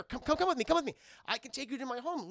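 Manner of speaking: high, loud, insistent coaxing; urging. Acted. Blind man offers to take weak stranger home, urging. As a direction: hurry them along with eager concern.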